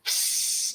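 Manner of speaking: unvoiced hissing "psst" of a fountain of blood; spray the sound through the teeth like gushing blood; imitated blood spray. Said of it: "It's like".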